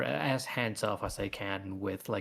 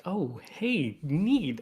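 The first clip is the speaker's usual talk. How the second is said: higher, louder mock reaction of delight; pleasantly surprised; 7 semitones higher, 4 dB louder, much slower than usual. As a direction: imitate a pleased little discovery. Pleased reaction to seeing own work.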